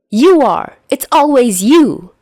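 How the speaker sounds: high, loud accusing yell; angry shout. Acted. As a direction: hurl it back at him. Shouting match between boys.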